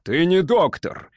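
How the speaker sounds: indignant storybook yell; angry shout. A narrator's reading. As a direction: cry it out in accusation, slowly. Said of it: "кричал Гилмен".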